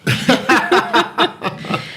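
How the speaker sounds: airy unvoiced laugh; breathy laugh. Real, not acted. Laughs after reading message.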